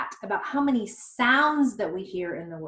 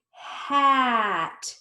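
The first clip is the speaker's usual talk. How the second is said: "Hat" stretched with a long vowel; blended slowly; 3 semitones higher, 4 dB louder than usual. Shown as a demonstration. Teacher blends hat for children, "Hat" slowly stretched. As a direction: draw "Hat" out in one slow blend. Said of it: "Let's blend it slowly".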